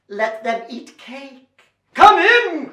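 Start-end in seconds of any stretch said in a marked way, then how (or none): 1.9-2.7 high, loud call; king's hearty cry. A narrator's reading. King inviting them to lunch, hearty cry. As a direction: call them in with royal gusto. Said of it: "cried the king with a yum".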